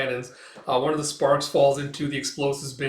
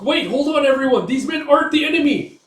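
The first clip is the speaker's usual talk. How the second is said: high, loud intervening plea; urgent protest; 12 semitones higher, 8 dB louder than usual. Retold or imitated. Young man defends his allies, urgent shouted protest. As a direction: call everyone to a halt. Pleading to halt attack.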